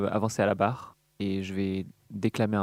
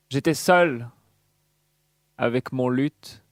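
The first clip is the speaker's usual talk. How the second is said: hammy stage actor. Acted. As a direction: declaim like a hammy tragedian. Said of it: "je vais déclamer un poème".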